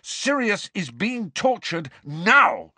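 high, slow, emphatic shout. A narrator's reading. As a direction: shout it in anguish. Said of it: "shouted Harry".

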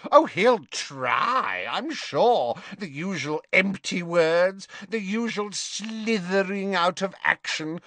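high, sneering scorn; mocking Bellatrix. A narrator's reading. Bellatrix mocking Snape to Narcissa, sneering. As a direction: drag out the sarcasm.

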